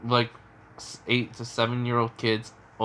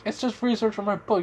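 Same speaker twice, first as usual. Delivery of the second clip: a hint of a flustered old man, voice jumping higher; indignant Jiraiya; 10 semitones higher, faster than usual. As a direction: protest in a high, flustered squeak. Jiraiya defends his writing.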